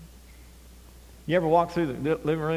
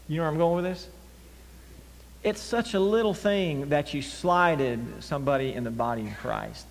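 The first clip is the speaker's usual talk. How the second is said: ordinary talk, nothing marked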